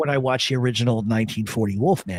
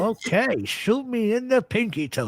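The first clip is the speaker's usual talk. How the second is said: movie character voice; 9 semitones higher than usual. Retold or imitated. Quoting Harlem Nights movie scene.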